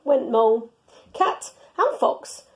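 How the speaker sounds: ordinary delivery, nothing marked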